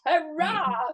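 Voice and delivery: high-pitched little celebration; mock cheer. Presenter celebrates finally being recognised, mock cheer. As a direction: cheer yourself in mock triumph.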